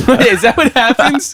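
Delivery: high, incredulous question; surprised. Real, not acted. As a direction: ask it in laughing disbelief. Mock disbelief, laughing.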